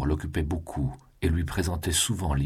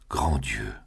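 a lower, overcome cry of relief, understated; lightly voiced joyful outburst; 3 semitones lower than usual. A narrator's reading. Julien alone, relieved she lives, mild overcome cry. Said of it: "s'écria Julien".

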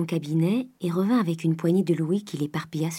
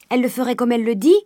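loud, fast, high servant's outcry; cried out; 5 semitones higher, 6 dB louder, faster than usual. A narrator's reading. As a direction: blurt it out loudly in warning. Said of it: "cria Nanon". (cri vif, fort et rapide)

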